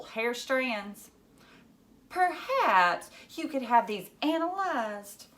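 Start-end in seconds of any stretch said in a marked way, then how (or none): 2.1-5.3 put-on slow, higher, prim girl; prim know-it-all girl voice; prim suggestion. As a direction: suggest it like a little detective. Phoebe suggests primly.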